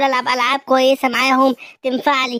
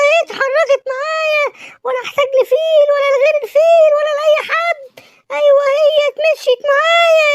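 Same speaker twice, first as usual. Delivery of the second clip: much higher, excited cartoon voice; thrilled kid voice showing off a new car; gleeful high character voice; 11 semitones higher, 3 dB louder than usual. Joyful character drives his dad's car, high and excited.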